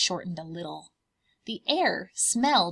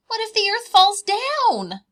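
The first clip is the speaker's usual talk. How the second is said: high, louder little cub asking; 13 semitones higher, 11 dB louder than usual. Acted. Bear cubs asking Mama, high child voice. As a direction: pipe up like a curious bear cub.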